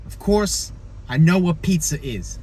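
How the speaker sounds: ordinary delivery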